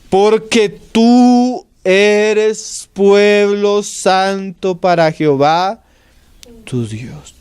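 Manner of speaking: slow, solemn Bible reading that fades to a whisper at the end; solemn scripture reading. Acted. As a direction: read the verse slowly and let it die away. Scripture read slowly, fading to whisper. (lectura bíblica solemne y lenta)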